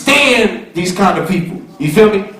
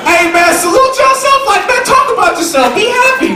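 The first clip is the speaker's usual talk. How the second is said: much higher, excited urging; hype call; 13 semitones higher than usual. Real, not acted. Rapper cheering the graduates to celebrate themselves, excited hype. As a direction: pump the crowd up, high and eager.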